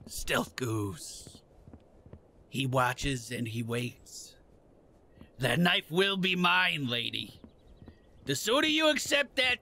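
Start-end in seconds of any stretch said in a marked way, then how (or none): 0.1-1.4 hushed stealth voice. Pretend. Narrating own sneaking. 2.5-7.4 low, slow menacing narration; lightly voiced sinister narrator; low villain narrator.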